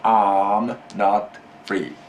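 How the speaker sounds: slow, deliberate prompt for the student; slow teacher prompt. Shown as a demonstration. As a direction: spell out each word slowly.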